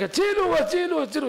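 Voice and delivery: high-pitched panicked cry for help. Acted. Sleeper being choked in a nightmare, crying out for help. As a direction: call out for rescue, pitched far up. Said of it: "זה צועק".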